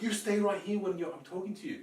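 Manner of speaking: retold indignant demand; angry woman imitated. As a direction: order him to stay, mimicking her. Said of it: "she got angry at me".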